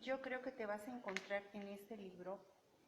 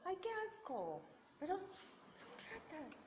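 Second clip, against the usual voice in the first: imitating disgusted daughter; 8 semitones higher than usual. Mother quotes her daughter's reaction to killer rats, imitating disgust. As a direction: quote a grossed-out daughter in a higher voice. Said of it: "Y nada más me dijo".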